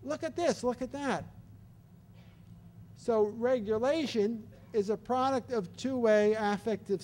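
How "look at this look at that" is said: high, lively "look at this"; more energy. Shown as a demonstration. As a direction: perk up to catch a drifting baby's attention. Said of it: "upregulate with a little more energy".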